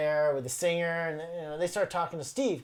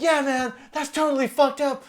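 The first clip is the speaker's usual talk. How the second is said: disapproving agreement; 8 semitones higher, 5 dB louder than usual. Retold or imitated. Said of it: "And Ian MacKay's like".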